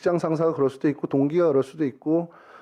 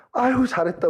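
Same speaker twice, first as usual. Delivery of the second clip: a pitched-up, lightly voiced mother gushing; proud mother praise; 8 semitones higher than usual. Mother praising her son for bringing home stolen money, gushing. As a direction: gush over the son like a doting mom.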